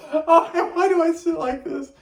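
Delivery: cracking up while trying to speak; giggling words squeezed out high; laughing. Real, not acted.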